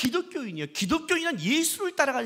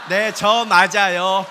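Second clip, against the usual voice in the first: loud, slow mock-grand reply; proud admission; 2 semitones lower, 11 dB louder, much slower than usual. Pastor to the optician, hammed-up loud admission. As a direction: draw it out grandly, louder. Quoting his own reply.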